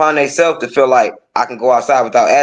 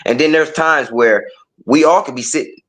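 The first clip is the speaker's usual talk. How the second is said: normal talking voice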